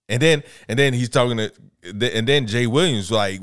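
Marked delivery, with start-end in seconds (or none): none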